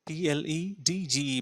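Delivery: spelled letter by letter, slowly; spelling out pledge. Shown as a demonstration. Teacher spells pledge for the students, letter by letter. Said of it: "প্লেজ শব্দ শুনে থাকতে পারবেন".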